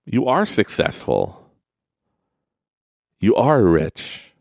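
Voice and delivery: slow, stressing ARE; slow affirmation. Shown as a demonstration. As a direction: lean on "are" slowly. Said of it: "tell yourself that you are awesome".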